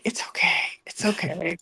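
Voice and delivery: hushed, imitating his easygoing reply; soft reassurance. Him telling her he doesn't mind, softly imitated reassurance. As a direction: wave the worry away quietly.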